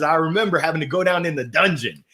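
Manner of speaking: ordinary delivery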